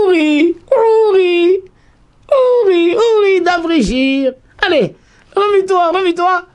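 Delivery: high, loud, pleading call of her name. Acted. Heaven calling Deborah to wake and sing, high, loud and pleading. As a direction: cry out from above, urging her to rise. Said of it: "c'est la réponse du haut".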